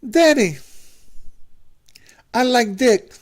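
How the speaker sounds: very high, halting son, sigh included; a son about to come out to his father, very high voice; son's high voice. Acted. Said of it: "why are you talking like that".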